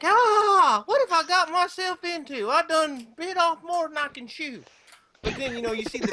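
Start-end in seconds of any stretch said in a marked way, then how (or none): 0.0-4.6 high, panicky yelp trailing off; panicked Eowyn. Retold or imitated. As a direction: voice her sudden dread, pitched up high. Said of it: "her face is like".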